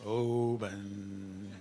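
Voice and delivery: low, long foghorn drone on "Oban"; drawn-out, droning foghorn call; foghorn imitation. Shown as a demonstration. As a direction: intone Oban like a distant foghorn. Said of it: "think of foghorns".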